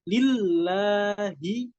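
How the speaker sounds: "lillahi" with weight on the doubled l; stressed doubled lam. Shown as a demonstration. Teacher showing the stressed lam in "lillahi". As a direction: press the shadda in "lillahi", slowly. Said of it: "harus diberikan tekanan".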